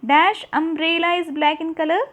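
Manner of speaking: slow loud classroom reading; slow exercise reading. Shown as a demonstration. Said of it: "exercise read aloud".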